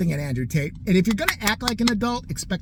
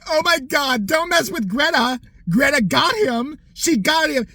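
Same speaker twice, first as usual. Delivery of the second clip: higher, louder gushing; mock excited fans; 8 semitones higher, 5 dB louder, slower than usual. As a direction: hype it like gushing fangirls.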